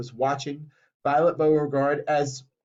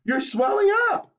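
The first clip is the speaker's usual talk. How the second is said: shrieking scream. A narrator's reading. Mother screaming as her daughter swells up, very high. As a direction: scream it in horror. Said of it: "screamed Miss Beauregard".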